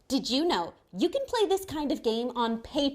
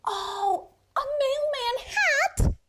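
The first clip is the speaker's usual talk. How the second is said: much higher, slow, thrilled question; delighted surprise; 11 semitones higher, 6 dB louder, slower than usual. Pretend. Host delighted by the puppet's mailman hat idea, high and thrilled.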